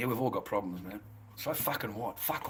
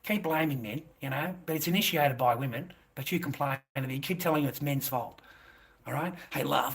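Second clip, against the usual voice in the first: usual speaking voice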